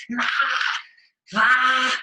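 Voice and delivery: high, loud mock scream; wordless shriek imitating a woman in a row; loud screamed "rah" in imitation; imitated screaming. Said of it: "like screaming".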